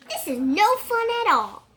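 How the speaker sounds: high, loud complaining fish; high whiny protest. Acted. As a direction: cry it out like an upset little fish.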